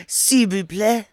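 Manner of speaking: fake French accent. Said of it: "ready to pretend to speak French".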